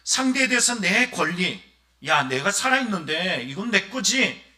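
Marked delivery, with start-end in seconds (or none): 2.1-4.5 louder and slower, a stern father laying down the law. Acted. Father scolding his son over the inheritance, stern and loud. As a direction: claim what's yours, slowly and loudly.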